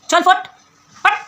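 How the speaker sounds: sharp, high, loud dismissal; dismissive shout. Real, not acted. Vlogger telling rival to get lost, dismissive shout.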